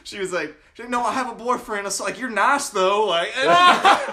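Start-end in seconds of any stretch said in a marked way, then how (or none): 0.9-1.8 high-pitched imitation of covering up; put-on flustered insistence; mock defensive backpedal. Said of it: "she's trying to cover her ass so bad". 2.2-3.1 loud, high imitation of a flustered coworker; hammed-up consolation; mock awkward backpedal. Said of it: "she's trying to cover her ass so bad".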